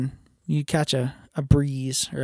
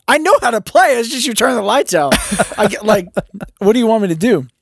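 higher, louder, indignant; exasperated protest; 8 semitones higher, 5 dB louder than usual. Retold or imitated. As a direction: push back, baffled and annoyed. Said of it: "I was like".

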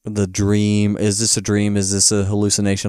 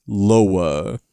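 dark lord command; 2 semitones lower than usual. Retold or imitated. Gnome King commands Mombi.

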